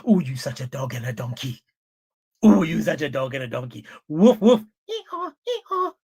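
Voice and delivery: mocking husband voice ending in barking and braying. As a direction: ham up the insults, then woof and hee-haw. Mocking a husband insulting his wife.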